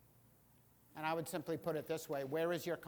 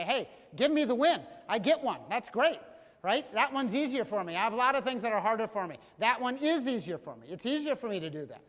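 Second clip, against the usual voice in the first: higher, louder, pleading for a win; half-playful protest to the congregation; mock exasperated pleading; 4 semitones higher, 7 dB louder than usual. Pastor plays himself pleading for credit, higher and louder.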